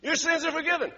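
high, loud repeat of "Your sins are forgiven"; proclaiming Jesus. Acted. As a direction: declare it boldly again.

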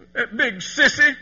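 put-on loud, slow pouting child voice; pouting little boy. Little boy pouting loudly at his mother about Daddy. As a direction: sulk it out like a five-year-old. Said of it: "puckered up and said".

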